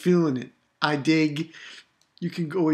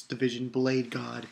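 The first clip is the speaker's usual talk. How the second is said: ordinary talk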